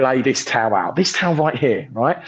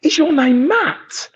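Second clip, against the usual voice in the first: put-on young lad; 10 semitones higher, slower than usual. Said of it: "and he went".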